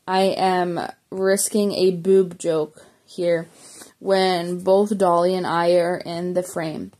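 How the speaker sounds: ordinary delivery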